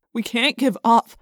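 mildly insistent argument; stubborn. A narrator's reading. Jake arguing against giving up, stubborn. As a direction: hold your ground firmly. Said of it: "Jake argued stubbornly".

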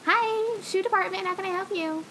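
high-pitched phone greeting at work; customer service voice. Retold or imitated. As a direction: answer the store phone sweetly. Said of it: "But usually I was like".

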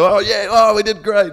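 high, loud bro cheer; hammed-up jock celebration; mock cheering. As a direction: pump it up like a happy weekend soldier. Acted reserves cheering, voice raised.

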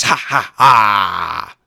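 slow, loud villain cackle; evil laugh. Acted. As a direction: cackle like a mad scientist. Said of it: "Then Doctor Wily laughed".

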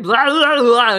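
mock rampage yell; wild attack noise. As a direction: blurt a silly berserker noise. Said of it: "I'm just gonna be like".

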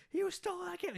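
very high mock accusation; imitated freak-out. Traveller blowing up at companion over card, imitated freak-out. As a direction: act out losing it, pitch shot right up. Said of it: "so he's freaking out".

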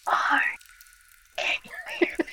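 hushed stage whisper; unvoiced-sounding whispered call, all air; whisper-yelling. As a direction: whisper as if calling to someone far above. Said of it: "whispering yelling".

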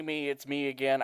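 ordinary delivery

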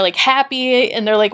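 ordinary delivery

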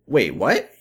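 high, hammed-up bafflement; mock confusion. Mocking confused characters in the episode, hammed-up surprise. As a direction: play dumb and shocked.

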